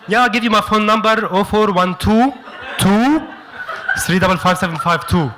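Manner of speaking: hammed-up Arabic accent on English; heavy Arabic accent. Giving a churchgoer a phone number in a hammed-up Arabic accent. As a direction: lay a thick Arabic accent over the English. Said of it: "You put the heavy accent on".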